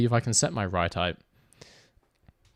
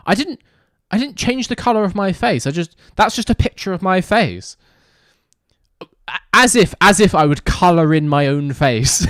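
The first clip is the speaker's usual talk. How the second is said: indignant protest; 6 semitones higher, 7 dB louder than usual. Real, not acted. Denying the blackface accusation.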